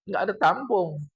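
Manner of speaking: normal talking voice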